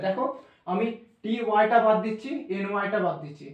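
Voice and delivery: ordinary talk, nothing marked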